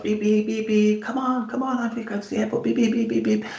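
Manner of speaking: high, fast mock beeping at the coach; playful high-pitched horn noises, mock impatience; impatient honking. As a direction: toot like a fed-up driver stuck behind a coach. Ben stuck behind coach honking.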